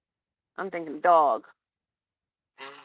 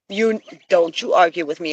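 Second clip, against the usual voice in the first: ordinary talk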